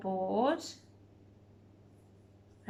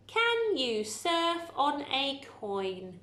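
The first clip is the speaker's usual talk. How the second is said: slow reading; 3 semitones higher, slower than usual. Shown as a demonstration. Teacher reading the sentence slowly to pupils. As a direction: read each word out slowly and evenly.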